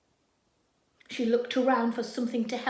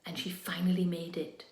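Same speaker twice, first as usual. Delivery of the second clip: hushed narration; 6 semitones lower, 6 dB quieter than usual. A narrator's reading. Storytelling hush.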